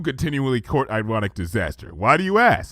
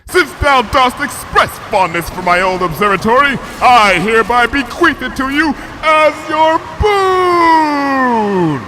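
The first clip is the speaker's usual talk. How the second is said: high, loud royal shout, slowing at the end; bellowed; 14 semitones higher, 8 dB louder, slower than usual. A narrator's reading. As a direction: proclaim it grandly over the wind. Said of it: "bellowed Luna over the roar".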